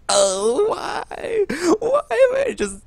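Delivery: high, drawn-out mock wail; hammed-up cry of woe; mock anguish. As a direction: wail it high in comic despair. Acts out anguish at getting duplicates.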